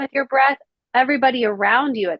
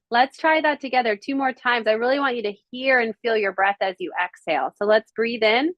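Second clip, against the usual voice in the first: guided breathing count. Acted. Coach counting breaths for the class, calm and unhurried. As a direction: ease the class through inhale and exhale. Leads group breath hold.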